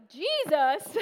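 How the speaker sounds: loud shocked one-word question; stunned disbelief; incredulous. Retold or imitated. Drunk friend stunned to hear about Jesus, loud shocked question. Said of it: "She's like".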